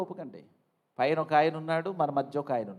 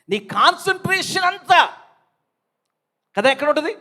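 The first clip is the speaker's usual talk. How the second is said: loud quiz; 12 semitones higher, 9 dB louder than usual. Real, not acted. Preacher quizzing congregation.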